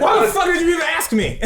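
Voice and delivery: an annoyed waiter snapping at an indecisive customer, shouted; mock outraged yell. Acted waiter outburst.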